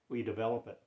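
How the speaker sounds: ordinary talk, nothing marked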